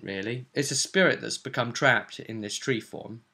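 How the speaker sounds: normal talking voice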